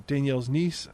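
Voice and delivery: ordinary talk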